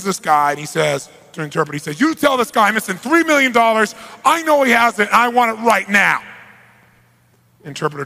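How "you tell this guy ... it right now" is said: loud, higher, forceful boss demand; angry demand. Retold or imitated. Mafia boss demanding his money from the accountant, angry and loud. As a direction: bark it like a furious mob boss.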